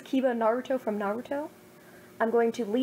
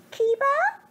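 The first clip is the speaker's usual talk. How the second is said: squeaky sleepy Naruto, voice jumping high and loud; just woken, blurt his name up high; hammed-up drowsy boy; groggy high voice; 5 dB louder than usual. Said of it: "he asked groggily".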